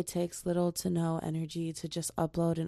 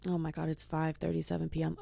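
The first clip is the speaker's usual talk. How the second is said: ordinary talk, nothing marked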